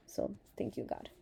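soft, emotional gratitude close to tears; choked-up thanks. Real, not acted. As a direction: thank God quietly, voice caught by feeling. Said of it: "getting emotional 'cause of, of my gratitude".

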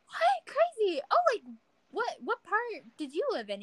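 high-pitched retold amazement; astonished. Passenger reacting to driver's shared city, astonished. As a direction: act out disbelief, starting very high and easing down.

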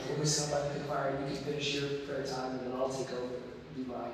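low, soft whisper-like invitation. Retold or imitated. Gently inviting his teammate to pray by the fire. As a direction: speak softly so no one wakes. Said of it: "pat her on the shoulder 'cause I don't want to yell".

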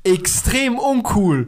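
high, drawn-out deadpan verdict; slow, high, emphatic put-down; mocking emphasis. Real, not acted. Mocking the failed bike drift.

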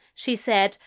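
ordinary delivery, nothing marked

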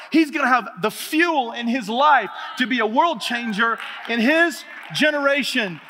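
loud, rising preacher climax; preaching at full force. Real, not acted. Pastor rallying his church, loud fervent preaching. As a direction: drive it home to the congregation.

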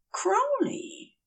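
high, soft, pleading call; fevered angel pleading with Crowley; plaintive protest. A narrator's reading. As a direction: whine his name in protest.

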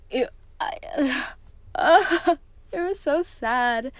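a teary half-laugh, slow and choked; laughing through tears. Real, not acted. Vlogger getting teary telling about a sad book, teary laugh. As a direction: crack up and tear up at once.

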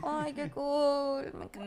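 soft, very slow, shrunk-in mock fangirl; shrunken shy gush. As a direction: squeeze yourself small and coo "qué cool". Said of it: "Como que yo me comprimo así".

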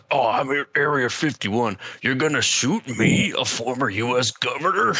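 hammed-up serious tough-guy protest; imitating Jesse Ventura. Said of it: "he's like, to all serious".

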